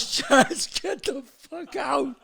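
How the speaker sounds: high, drawn-out angry order; screaming father. Retold or imitated. Father orders son's friend out, high slow angry yelling. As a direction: throw him out, voice strained high. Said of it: "They're all screaming this".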